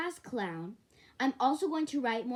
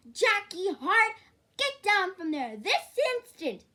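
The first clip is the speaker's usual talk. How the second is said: angry yell; 10 semitones higher, 8 dB louder than usual. Acted. Parent yelling from below.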